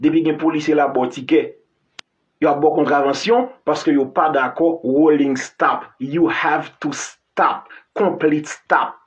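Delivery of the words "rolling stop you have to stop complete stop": slow English quote. Shown as a demonstration. Quoting US traffic rules slowly.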